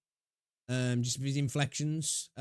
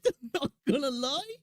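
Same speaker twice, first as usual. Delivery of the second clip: laughing it out, pitch up high; can't keep a straight face; giggling through the words; 9 semitones higher than usual. Real, not acted. Laughing at cheek pulling.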